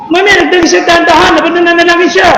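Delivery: usual speaking voice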